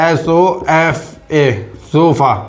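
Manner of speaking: slow, letter by letter; spelling it out slowly. Shown as a demonstration. Teacher slowly spells the word for students to write. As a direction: spell each letter out for the class. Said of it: "sofa, sofa".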